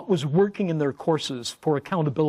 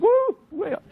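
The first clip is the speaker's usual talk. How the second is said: excited whoop. Shown as a demonstration. Arousal spikes on sudden look.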